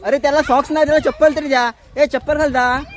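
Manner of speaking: loud, high instructions; shouted orders. Real, not acted. Shouting orders about footwear.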